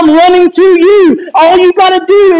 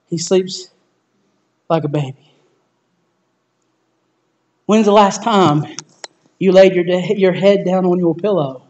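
low and much softer, intimate; hushed preaching; 10 semitones lower, 7 dB quieter than usual. Real, not acted. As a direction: bring the room down to a calm close. Closes the sermon in hushed tones.

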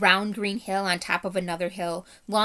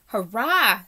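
shrill small hurrah; 3 semitones higher, 7 dB louder than usual. A narrator's reading. Mouse cheering from the doctor's feet, small and piping. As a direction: squeak it out like a tiny brave mouse. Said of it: "a very shrill and small voice".

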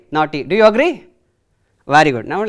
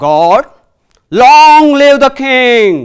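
booming, slow royal greeting; loud proclamation; 7 semitones higher, 10 dB louder, much slower than usual. Acted. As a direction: announce it to the court, slow and loud. Teacher voicing god's loud greeting.

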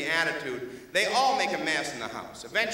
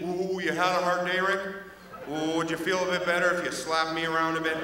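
submissive dog voice; 3 semitones lower than usual. Acted. Dog voiced apologizing to Rick.

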